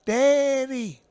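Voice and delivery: high, far-off sing-song call of Daddy; effeminate Daddy call. Retold or imitated. The son calling out to his father across the circle, high put-on voice. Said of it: "the one kept saying".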